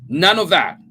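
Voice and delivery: loud, curt cutting-off; husband sharply shutting the topic down; sharp rebuke. A narrator's reading. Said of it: "Jim said sharply".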